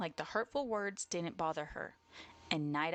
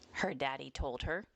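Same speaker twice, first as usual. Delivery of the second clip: plain reading voice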